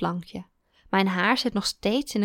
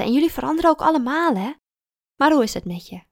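fast, louder, higher chatter; chatty tipsy teacher; 5 semitones higher, 5 dB louder, faster than usual. Acted. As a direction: rattle it off cheerfully. Teacher voiced.

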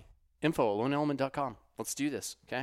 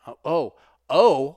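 slow, louder, higher sudden excitement; excited; 4 semitones higher, 13 dB louder, slower than usual. Real, not acted. Host recalling mountaineer's feat, excited outburst. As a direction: burst in like you just remembered. Suddenly remembers big news.

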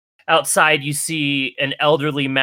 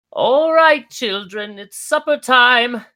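put-on high, slow call to the children; an elderly caretaker calling the kids in for supper; old mouse calling; 13 semitones higher, 3 dB louder, slower than usual. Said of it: "you hear the old mouse folk say".

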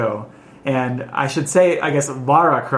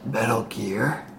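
slow, softer Solid Snake rasp; 3 semitones lower, 7 dB quieter, slower than usual. Pretend. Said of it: "Here's my snake impression".

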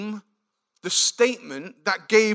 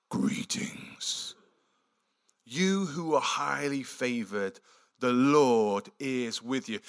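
Gabriel greeting; 7 semitones lower, 5 dB quieter, slower than usual. Acted.

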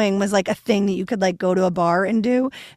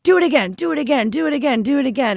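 higher, evenly repeated mock demand; eager repetition; 4 semitones higher, faster than usual. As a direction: imitate a group egging itself on. Retelling eager repeated throwing.

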